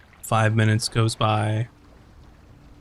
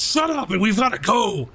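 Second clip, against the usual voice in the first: higher snappy pirate voice; Karzak snapping; 8 semitones higher than usual. Acted. Karzak telling the crew to shut up, higher impatient voice. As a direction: cut them off, impatient. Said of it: "and then Karzak goes".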